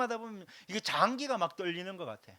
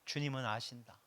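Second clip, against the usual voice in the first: soft comfort; 7 semitones lower, 7 dB quieter than usual. Shown as a demonstration. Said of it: "위로받으시기 바랍니다".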